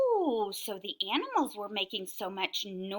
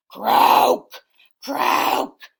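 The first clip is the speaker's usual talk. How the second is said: loud, rough croak; frog croaking; 14 dB louder than usual. Acted. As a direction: belt out a frog's croak. Animal noises in read story.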